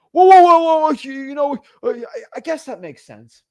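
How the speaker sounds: loud, high whoa, then backing down; startled seller. Retold or imitated. Seller startled the buyer could come within the hour. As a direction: pull up short, then concede.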